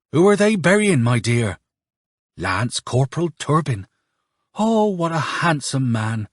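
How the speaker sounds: lightly voiced gossiping onlookers; peasant women. A narrator's reading. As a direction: ask and admire, a hint of village women. Peasant women ask at the gate.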